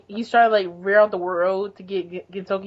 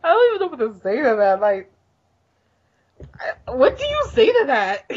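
words breaking up in laughter; laughing helplessly; 3 semitones higher than usual. Real, not acted. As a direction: try to talk through a laughing fit. Laughing at partner's bit.

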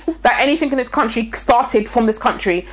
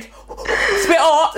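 slow, laughing, higher drawn-out "It's a bit hot"; mock sweating Brit; 5 semitones higher, much slower than usual. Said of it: "'Cause that's not spice".

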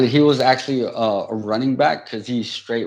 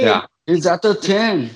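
excited, slow play-by-play call, higher and louder; frantic mock sports commentary; 8 semitones higher, 5 dB louder, slower than usual. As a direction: call the cat's run like a hyped announcer. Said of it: "commentating on the cat".

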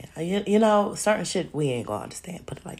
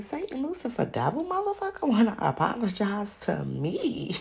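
high, incredulous question; shocked disbelief; 6 semitones higher than usual. Retold or imitated. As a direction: repeat it in utter disbelief. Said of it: "But I was shocked".

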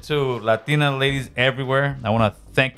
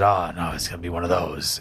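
dismissive groan; 7 semitones lower, 4 dB quieter than usual. Retold or imitated. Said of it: "And I said".

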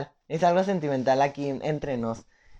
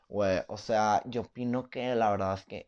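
lightly mimicked fresa classmate, a bit lower and softer; a hint of a snooty rich kid giving his opinion; posh fresa student; 8 semitones lower, 8 dB quieter than usual. Imitating a fresa classmate talking in the first class. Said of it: "súper fresa".

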